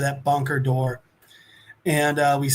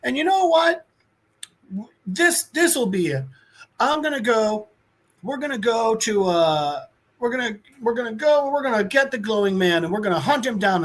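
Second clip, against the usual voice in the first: put-on higher-pitched blustering boy king; kid acting tough; 7 semitones higher than usual. Kid king holding court, mock-tough bluster. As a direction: puff up like a child playing boss. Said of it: "trying to be like".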